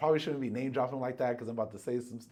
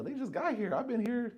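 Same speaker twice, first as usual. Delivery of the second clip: indignant; 11 semitones higher than usual. Retold or imitated. Retelling indignant reaction to rejection.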